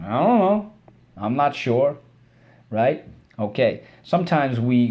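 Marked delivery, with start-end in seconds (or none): 0.1-2.0 a light shrugging I don't know, higher; mock shrug. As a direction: act out a careless shrug. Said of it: "when you move your shoulders".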